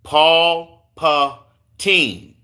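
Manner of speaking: "Paul Patine" very slow, syllable by syllable; sounding out "Paul Patine" as if learning it; careful pronunciation. Real, not acted. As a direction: stretch out "Paul Patine" with gaps between syllables. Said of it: "Did I say it right?".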